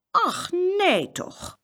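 high, very slow exclamation; astonished dismay. A narrator's reading. Farmer's wife hearing they were lost, drawn-out dismay. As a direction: stretch it out in shocked sympathy.

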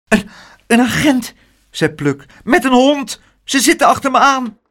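high, loud, hurried panic; frightened boy. Acted. Pluk tells the bookseller the police are after him, frightened. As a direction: blurt it out in fright.

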